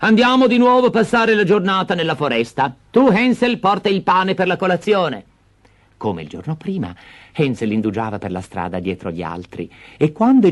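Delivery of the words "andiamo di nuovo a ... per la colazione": high, louder commanding stepmother; bossy stepmother. Acted. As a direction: give orders. Stepmother orders.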